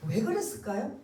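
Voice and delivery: ordinary delivery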